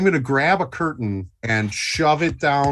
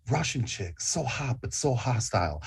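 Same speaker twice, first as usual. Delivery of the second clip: much softer, lower murmur; muttered aside; 4 semitones lower, 8 dB quieter than usual. Acted. Luther eyeing the hostile Russian woman, softly murmured. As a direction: say it under your breath. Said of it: "gonna look at Lou Stiles and go".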